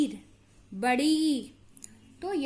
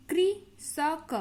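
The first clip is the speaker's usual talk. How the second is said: slow syllable-by-syllable demo; 2 semitones higher, much slower than usual. Shown as a demonstration. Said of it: "तो इस तरीके से री का प्रोनाउंस करते हैं".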